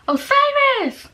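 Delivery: high, drawn-out call of a name; high, slow call of Osiris from a distance. Retold or imitated. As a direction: call her name from down the street. Someone calling her name.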